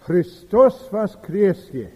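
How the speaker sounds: Christos voskresie, slow and solemn in Russian; Russian liturgical greeting. Retold or imitated. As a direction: intone Christos voskresie like a priest. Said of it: "the priest comes from behind the screen and says".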